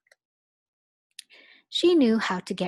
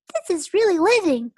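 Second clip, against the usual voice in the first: high, fast and loud; delighted kids; 11 semitones higher, 8 dB louder, faster than usual. Acted. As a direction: gush with joy. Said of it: "they said".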